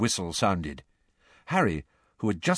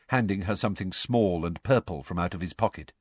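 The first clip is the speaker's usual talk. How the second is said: normal talking voice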